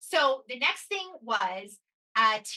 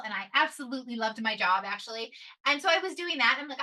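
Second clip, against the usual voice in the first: ordinary talk, nothing marked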